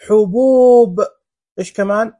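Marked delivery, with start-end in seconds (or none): 0.1-1.0 "حبوب" loud with a held oo; long oo vowel. Shown as a demonstration. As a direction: draw out "حبوب" as huboooob. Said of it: "المد بالواو". ("حبوب" بمدّ الواو عالياً)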